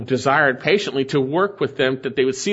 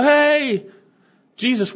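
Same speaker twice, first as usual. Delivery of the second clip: a pitched-up mock cry of "Hey, Jesus"; mock yell; 8 semitones higher, slower than usual. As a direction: call out like a desperate last-second plea. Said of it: "they yell".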